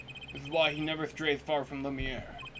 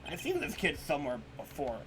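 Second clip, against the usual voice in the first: usual speaking voice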